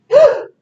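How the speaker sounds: sharp, high gasp of fright; startled gasp. Retold or imitated. Teacher reenacting finding the snake in the washer, sharp gasp. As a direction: gasp as if spotting something awful. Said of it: "and I was like".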